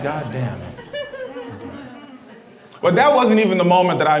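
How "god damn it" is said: deflated mutter. Retold or imitated. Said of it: "I was like".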